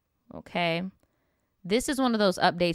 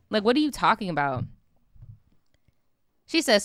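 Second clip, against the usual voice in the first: ordinary delivery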